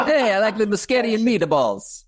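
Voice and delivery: high-pitched, hammed-up Italian accent; mock Italian accent. Jumping in after the guest declines to try, mock Italian accent. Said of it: "your best attempt at an Italian accent".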